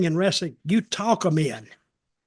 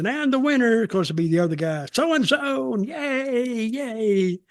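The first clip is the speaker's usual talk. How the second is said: ring announcer; 5 semitones higher than usual. Retold or imitated. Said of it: "and they'd say".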